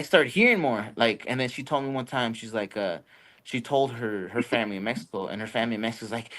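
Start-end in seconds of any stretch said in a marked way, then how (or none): none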